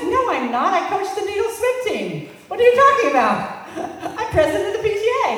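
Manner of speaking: high, loud protest breaking into laughter; laughing indignant denial. Retold or imitated. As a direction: protest your innocence through laughter.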